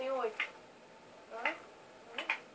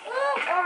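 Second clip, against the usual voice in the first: startled yelp; 9 semitones higher, 10 dB louder than usual. Real, not acted. Startled by danger.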